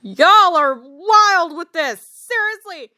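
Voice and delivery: slow and loud, half laughing; slow incredulous drawl. Real, not acted. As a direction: drag it out in disbelief. Reacting to the text.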